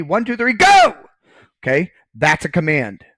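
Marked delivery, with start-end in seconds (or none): 0.0-1.0 quick count rising to a loud, high "go!"; race start call. Acted. Teacher acts out a race starter, shouted "go!". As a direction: count off, then launch the runners on a high shout. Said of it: "in a race ... they say".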